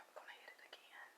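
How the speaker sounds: quick whisper; whispering before knocking back the second shot. Real, not acted. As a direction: say it fast under your breath.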